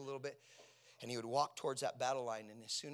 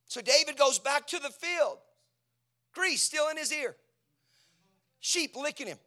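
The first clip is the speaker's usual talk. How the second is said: fiery preaching; 10 semitones higher, 10 dB louder than usual. Acted. Preaching at full force.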